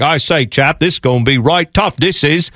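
put-on lightly done posh British accent; a hint of an English gent; mock upper-class British accent. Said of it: "those two English soccer legends will be like".

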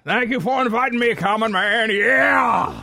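high, hammed-up concert shout; mock rock-star yell, stretched at the end; onstage rock guest. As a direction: holler thanks like a rock singer. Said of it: "start riffing".

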